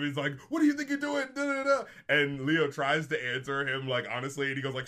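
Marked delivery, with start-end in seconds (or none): 0.5-1.8 high-pitched mock shouting; retold shout. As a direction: imitate someone yelling an indignant question. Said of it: "shouts at him".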